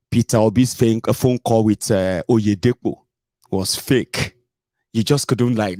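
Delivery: normal talking voice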